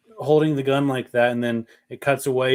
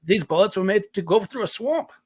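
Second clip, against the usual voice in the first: put-on high-pitched Australian accent that slides toward Shrek; bad Australian accent; 7 semitones higher than usual. Quoting the other guy about the bullets, bad Australian accent. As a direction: attempt an Australian movie character, badly. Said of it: "[name] kinda sounds like Shrek when he does an Australian".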